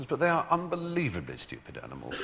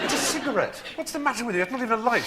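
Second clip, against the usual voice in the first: high-pitched comic scolding; mock exasperation; 12 semitones higher, faster than usual. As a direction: plead with an idiot horse, voice way up. Exasperated rider scolds nervy horse.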